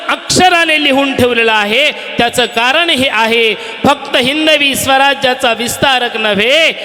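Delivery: rising from high to a shouted peak; preaching at full force. Acted. Preacher to the gathered crowd, climbing to a shout.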